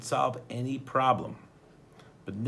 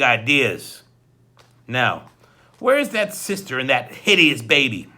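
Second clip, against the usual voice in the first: louder, menacing villain voice; menacing henchman; 4 semitones higher, 9 dB louder than usual. A narrator's reading. Hook-handed man threatens Klaus, loud and menacing. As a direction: loom over the boy and threaten.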